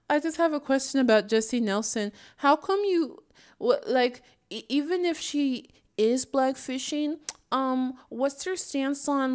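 a hint of a sweet troll feigning curiosity; mock troll asking. Vlogger mimics a troll commenter's innocent question. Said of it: "somebody in my other video was like".

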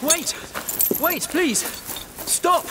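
high, urgent shout to stop them; urgent call. Acted. Priest calls after the guard to stop, high urgent shout. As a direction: call out high so they halt.

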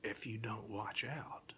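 spooky whispered refrain. A narrator's reading. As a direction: hiss the threat gently. Refrain warning.

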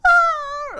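very high loud shriek; kid's scream imitated. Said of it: "he's screaming and yelling".

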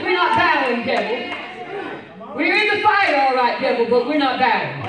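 shouted refusal to the devil; defiant shout. Acted. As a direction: hammer it home one last time. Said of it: "I won't say it one more time".

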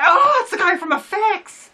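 high, loud, drawn-out cry of recognition; excited recognition. Retold or imitated. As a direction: re-enact a thrilled realisation, way up high. Retelling his excited moment of recognition.

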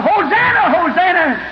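high-pitched acclaiming cry; hollering crowd. Acted. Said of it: "Others hollering".

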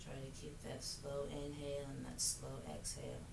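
soft, calm meditation voice; guided meditation. Acted. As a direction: guide them gently into stillness. Leads yoga practice softly.

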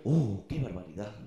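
put-on tut-tut like a prim old lady; mock scandalized voice. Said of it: "y digo".

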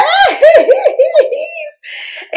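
very high, long giggle of delight; gleeful squealing laugh. Real, not acted. Thrilled at gift.

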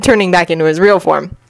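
ordinary delivery

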